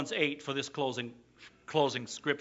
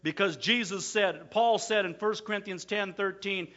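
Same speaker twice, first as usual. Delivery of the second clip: ordinary talk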